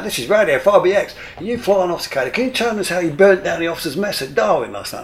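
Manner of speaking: high, loud on-air presenter; radio announcer. Retold or imitated. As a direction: sell it like a breezy radio host. Radio reporter's on-air intro.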